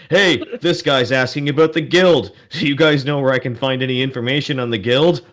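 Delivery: loud, high, mocking call across the bar; loud jeering human. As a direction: shout it across the tavern, mocking. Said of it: "and goes".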